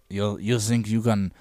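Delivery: mock French accent. Joining a friend mocking the French, low slow French accent. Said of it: "The French accent is also".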